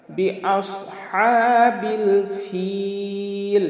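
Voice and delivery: ফিল with the ই held very long on one note; three-alif elongation. Shown as a demonstration. Teacher shows the long madd, ফিল drawn out three alifs. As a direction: chant slowly, stretching ফিল right out. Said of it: "ফিলে আমরা তিন আলিফ টান দেব".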